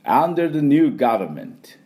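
「under the new government」 slow with a clear v in 「government」; slow model phrase. Shown as a demonstration. Teacher slowly models the phrase with a v. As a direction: lean on 「new」, bite the v. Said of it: "じゃあ行きますよ".

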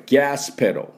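「ガスペダル」を高めに「ギャースペロー」; 「ガス」を「ギャース」、「ペダル」を「ペロー」で手本に; 英語発音の手本. Shown as a demonstration. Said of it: "この音です". (米国式に「ガスペダル」を「ギャースペロー」)

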